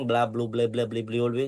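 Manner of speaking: gabbled nonsense syllables without a pause; rushed blah-blah imitation; rapid-fire babble. Shown as a demonstration. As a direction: mimic someone talking far too fast. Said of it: "cepet banget ngomongnya, nggak ada jeda".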